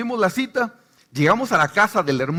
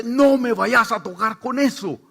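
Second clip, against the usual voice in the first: slow, louder threat from the possessed woman; menacing possessed woman; 5 semitones higher, 4 dB louder, slower than usual. Retold or imitated. Possessed woman warning the pastor: slow menacing threat. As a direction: warn him off in a slow, louder voice.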